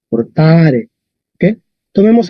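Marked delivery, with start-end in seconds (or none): 0.1-0.9 "Portare" repeated with a held, stressed A. Shown as a demonstration. Teacher repeats the Latin example for students, stressed long A in "Portare". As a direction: lean on the middle syllable of "Portare". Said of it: "la fuerza está en la A".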